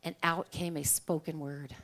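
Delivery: usual speaking voice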